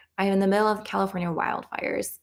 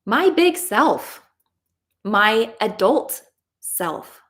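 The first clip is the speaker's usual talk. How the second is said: big, higher and louder on BIG, slowed; emphatic affirmation; 3 semitones higher, 4 dB louder, much slower than usual. Shown as a demonstration. Coach declaring the big adult self, slow and emphatic. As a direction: proclaim it proudly and slowly. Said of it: "affirmation, emphatic".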